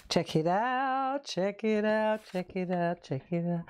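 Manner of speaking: drawn-out chant stepping down in pitch; playful echo. Pretend. Host shows off a new design, mock echo fading. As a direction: repeat it like an echo fading away.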